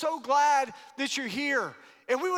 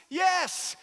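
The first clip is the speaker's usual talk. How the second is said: shouted yes; 8 semitones higher than usual. Real, not acted. Preacher answering own question.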